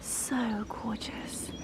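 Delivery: slow, admiring whisper. Real, not acted. Vlogger whispering over her flowers. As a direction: breathe it out in awe.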